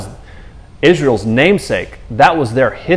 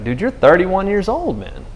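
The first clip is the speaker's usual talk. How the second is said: incredulous; 7 semitones higher than usual. Retold or imitated. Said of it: "It's like".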